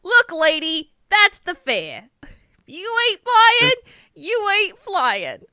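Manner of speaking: high, nasal-free goblin snarl. Acted. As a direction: squawk it like a cranky goblin. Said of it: "Now you're getting some of my goblin voice".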